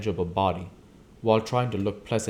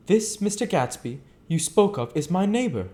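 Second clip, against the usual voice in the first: higher, careful gentleman's line; Nick speaking; 10 semitones higher, 3 dB louder than usual. Acted. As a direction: state it with polite interest. Nick's line, first take.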